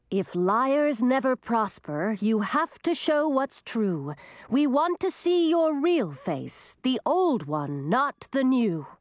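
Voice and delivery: slow, high-pitched rhyming spell; spell incantation. Acted. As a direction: intone the spell slowly and commandingly. Emma recites a rhyming spell.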